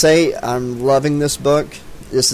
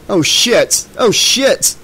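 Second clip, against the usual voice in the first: higher, startled outcry; alarmed cry; 7 semitones higher, 4 dB louder, much slower than usual. A narrator's reading. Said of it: "Devon cried out".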